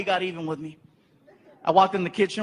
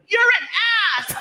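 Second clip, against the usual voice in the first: mock angry yell; 12 semitones higher, faster than usual. Girlfriend yelling on phone.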